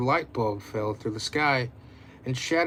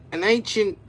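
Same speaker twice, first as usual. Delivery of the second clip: grand professor voice; 7 semitones higher, 4 dB louder than usual. Acted.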